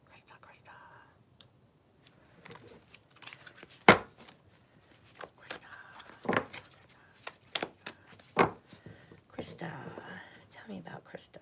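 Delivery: soft, breathy ritual whisper; hushed whisper while shuffling. Real, not acted. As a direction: whisper her name to the cards. Whispering during card ritual.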